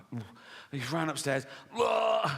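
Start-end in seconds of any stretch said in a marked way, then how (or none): 1.7-2.3 comic vomiting noise; mock retching. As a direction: act out throwing up. Acts out vomiting upstairs.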